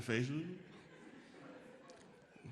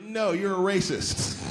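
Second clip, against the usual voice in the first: a touch of loud, drawn-out rebuke; higher, stretched-out accusation; scolding Google; 5 semitones higher, 6 dB louder, much slower than usual. Acted. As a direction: scold the user slowly, raised voice. Acts Google scolding the user.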